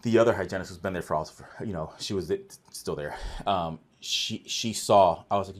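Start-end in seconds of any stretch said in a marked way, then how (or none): none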